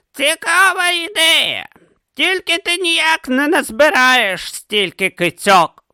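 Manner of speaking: put-on high, slow old philosopher. A narrator's reading. Didactylos answering Urn, high and slow. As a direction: drawl it out as the old teacher. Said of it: "зазначив він".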